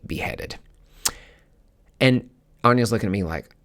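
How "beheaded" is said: drops to a breathy whisper on the last word. Real, not acted. Telling viewers about her past-life betrayal, whispered. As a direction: let the word beheaded fall away into a whisper.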